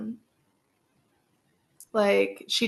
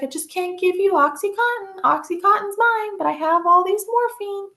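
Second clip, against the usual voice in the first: much higher, sweet doting grandmother voice; doting Nana; 11 semitones higher than usual. Retold or imitated. Nana offering her granddaughter morphine for a headache, high and cooing. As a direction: coo it up high like a grandma spoiling her baby. Voicing Nana sharing pills.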